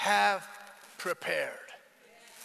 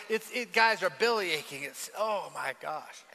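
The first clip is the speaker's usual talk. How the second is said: ordinary talk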